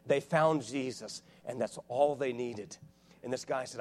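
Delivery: usual speaking voice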